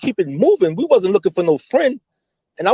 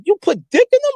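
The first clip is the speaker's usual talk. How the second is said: high, aghast question; high retold shock; shocked disbelief; 13 semitones higher than usual. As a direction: ask it as if you can't believe it. Retelling scolding Poe.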